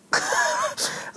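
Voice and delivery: bursting high airy laugh. Real, not acted. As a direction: crack up at the memory. Mother's surprised reaction, laughing. (hoge uitbundige lach)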